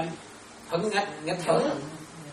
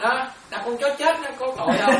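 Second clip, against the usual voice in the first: normal talking voice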